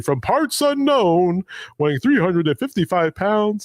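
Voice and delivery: high, stretched-out ring introduction; grand, drawn-out ringside call; old-school wrestling announcer. Acted. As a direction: announce it slowly to the whole arena. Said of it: "the way they'd introduce the wrestlers".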